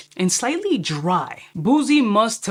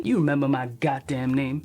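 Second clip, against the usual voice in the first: lightly acted low, quieter reply; mock smooth comeback; 6 semitones lower, 3 dB quieter than usual. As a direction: drop the pitch for an imagined cocky reply. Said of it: "This would be like".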